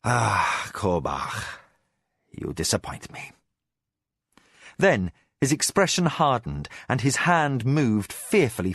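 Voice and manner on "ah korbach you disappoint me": soft low disappointed voice; almost whispered, gently regretful; regretfully mild. Acted. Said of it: "His tone sounded regretfully mild".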